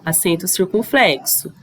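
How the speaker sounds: ordinary talk, nothing marked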